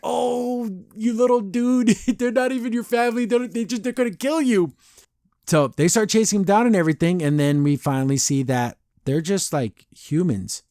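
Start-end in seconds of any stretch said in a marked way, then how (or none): 0.0-4.7 high-pitched, mock-heartbroken pity; pitying coo. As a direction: coo pityingly at a naive little one, voice way up high. Cooing pity for deceived little King.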